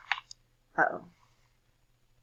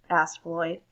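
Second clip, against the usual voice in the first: plain reading voice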